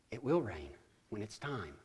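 low, very soft, unhurried; calm reassurance. Acted. Jesus waking to reassure worried farmers, quiet and calm. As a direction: say it as an unbothered Jesus.

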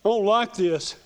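whiny little sulk, lightly done; pity party sulk. Retold or imitated. Preacher voicing his own pity party, mild whine. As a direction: mope it out like a sulking kid. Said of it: "one of those pity parties".

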